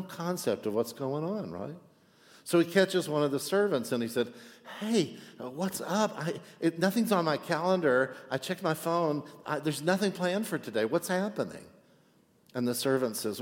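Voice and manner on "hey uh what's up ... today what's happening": lightly voiced, higher confused brother; a hint of a bewildered young man; puzzled older brother. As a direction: sound baffled and a bit lost. Older brother asking servant, voiced.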